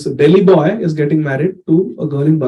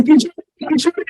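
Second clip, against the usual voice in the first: high calling out a name; retold yell; 10 semitones higher, faster than usual. Husband searching every village for his wife, calling high. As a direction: call out over a festival crowd. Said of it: "Everywhere he's been shouting".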